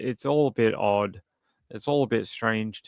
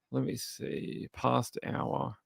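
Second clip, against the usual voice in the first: whispered under breath; whispering; 10 dB quieter, slower than usual. Real, not acted. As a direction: murmur it to yourself while searching. Searching, unvoiced.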